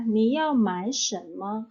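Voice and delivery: slow, careful Mandarin model read; slow model sentence. Shown as a demonstration. Teacher reading an example sentence to students, slow and careful. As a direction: spell it out slowly for learners. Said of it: "contoh kalimat pertama ya".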